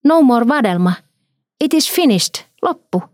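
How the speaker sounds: put-on high-pitched little child exclaiming; childish high voice, each phrase its own exclamation; innocent child's voice. A narrator's reading. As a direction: cry out innocently, like a small child. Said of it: "hän huudahti viattomalla lapsen äänellään".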